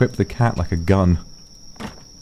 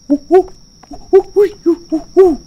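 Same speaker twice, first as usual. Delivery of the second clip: monkey hoots; 6 dB louder than usual. Pretend. Imitating monkey calls.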